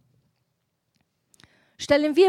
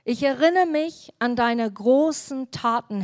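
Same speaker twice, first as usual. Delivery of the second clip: ordinary talk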